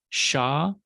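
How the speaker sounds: usual speaking voice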